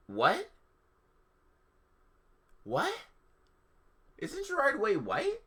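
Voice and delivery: high, incredulous double take; can't believe what you just read; real disbelief, pitched high; baffled. Real, not acted. Baffled reaction to the text.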